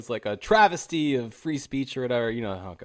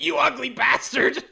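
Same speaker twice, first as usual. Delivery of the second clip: high-pitched mock heckle; imitated heckler; 12 semitones higher than usual. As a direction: jeer like someone at the back of the room. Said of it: "another person says".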